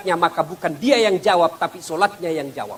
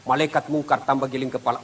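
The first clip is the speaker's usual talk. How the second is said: ordinary talk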